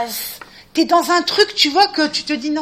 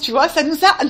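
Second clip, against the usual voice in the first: ordinary talk, nothing marked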